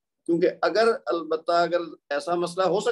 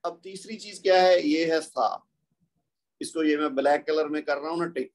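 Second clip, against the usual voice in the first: normal talking voice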